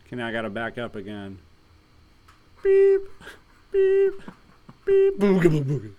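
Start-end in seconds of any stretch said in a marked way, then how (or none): 2.6-5.2 very high loud beeping; reversing truck beeps. Acted. Truck backing up. 5.2-5.9 silly noise. Acted.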